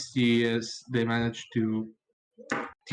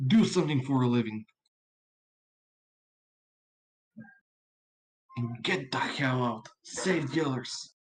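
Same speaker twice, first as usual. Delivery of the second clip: raised, stern town guard warning; guard's final warning; 2 semitones higher than usual. Acted. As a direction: bark a last warning at intruders. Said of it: "Jim's going to give out, presumably, a final warning".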